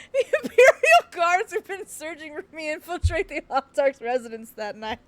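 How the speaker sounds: stunned, stressing imperial; incredulous reread. Real, not acted. Player rereads the absurd plot line, incredulous.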